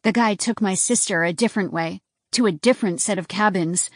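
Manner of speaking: usual speaking voice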